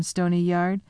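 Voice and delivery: ordinary delivery, nothing marked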